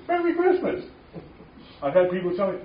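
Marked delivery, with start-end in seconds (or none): 0.0-0.8 very high festive call; cheerful greeting. Retold or imitated. Greeting everyone at Christmas, high and festive. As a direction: sing out the greeting. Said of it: "So I'm saying".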